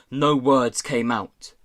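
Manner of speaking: ordinary delivery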